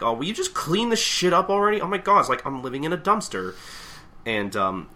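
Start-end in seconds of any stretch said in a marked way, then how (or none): 0.0-3.5 much higher, louder fed-up complaint; exasperated nagging. Retold or imitated. Shinpachi scolding Gintoki about the mess, higher, louder exasperation. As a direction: nag him to clean up, fed up. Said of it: "Shinpachi's like".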